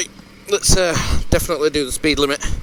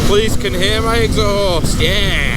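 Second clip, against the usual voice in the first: muffled under a closed visor; talking inside a motorbike helmet; 5 semitones higher, 5 dB louder than usual. Real, not acted. Wind noise when my visor is up.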